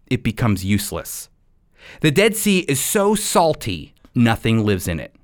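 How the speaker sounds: ordinary talk, nothing marked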